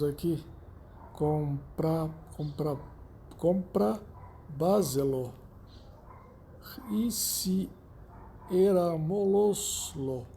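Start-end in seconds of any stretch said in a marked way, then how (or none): 1.1-10.2 "compra, compra, compra, baselo" attempted haltingly in pieces, then "era moloslo" drawn out; careful, difficult pronunciation. Shown as a demonstration. Teacher attempting unfamiliar sobresdrúxulas examples, halting and careful on "compra" and "moloslo". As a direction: struggle through long stressed-early Spanish words, "compra" restarted before "baselo". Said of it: "fica difícil a gente pronunciar".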